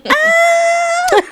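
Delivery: high piercing startled shriek; old lady scream. Retold or imitated. Retelling the auntie's scream, high shriek. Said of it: "that scream, I would never forget it".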